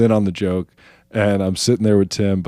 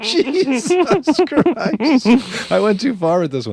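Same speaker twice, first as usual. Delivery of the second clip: much higher, stretched exclamation; dismayed; slower than usual. Retold or imitated. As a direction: groan it like you overdid it. His shocked reaction retold.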